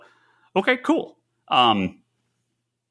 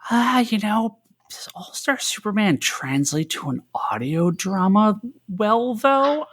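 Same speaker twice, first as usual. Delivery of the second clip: higher, musing skeptical voice; doubtful; 8 semitones higher, slower than usual. Retold or imitated. To co-hosts, doubting the audio drama, musing skeptically. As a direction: wonder aloud, unconvinced. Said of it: "I'm like".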